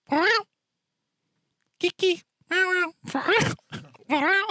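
put-on very high squeaky meowing baby talk; sing-song meows calling Kiki; cat noises to a cat. Shown as a demonstration. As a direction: coo at the cat in a tiny high voice. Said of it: "let's see if I can do it".

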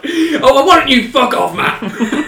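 hammed-up teen tantrum through laughter; Simon from Inbetweeners. Mocking his own outburst at the housemate, high laughing whine. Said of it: "I was like Simon from Inbetweeners".

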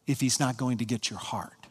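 ordinary talk, nothing marked

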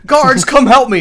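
high, loud panicked call; calling for help. Retold or imitated. King calling his guards for rescue, panicked shout.

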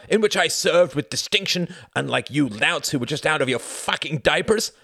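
stammered start building to loud outrage; indignant defence. A narrator's reading. The Count answers Jorgen about fascists, indignant outburst.

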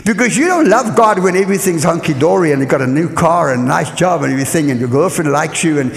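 ordinary delivery

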